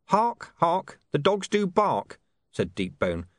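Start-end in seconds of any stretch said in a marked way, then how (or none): 0.1-2.2 high, slow, sing-song password; a secret code line, chanted; hidden gruff informer. A narrator's reading. Deep Bone's hidden password.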